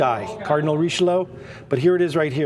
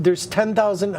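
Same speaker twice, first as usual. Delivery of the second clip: normal talking voice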